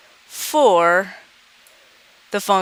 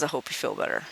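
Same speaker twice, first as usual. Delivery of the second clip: much softer and lower, sympathetic; sympathetic teacher; 6 semitones lower, 10 dB quieter, much faster than usual. Acted. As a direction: comfort a sad student gently. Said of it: "Teacher says".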